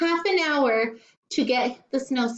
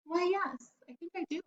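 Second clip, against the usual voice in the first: much softer, higher grandpa admitting it; a mild, meek old grandpa; sheepish grandpa; 8 semitones higher, 10 dB quieter than usual. A narrator's reading. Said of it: "And Grandpa said".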